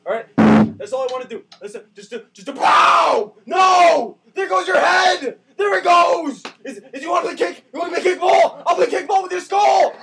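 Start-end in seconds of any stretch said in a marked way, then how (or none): 2.3-3.3 roaring slowly at the cop; mock furious yell. Gamer threatening the cop, slow roaring yell. Acted shooting rage. 3.5-9.9 loud, high taunting yells; mock maniac shouting. Gunman taunting his victim, high shouting. Acted killing spree taunts.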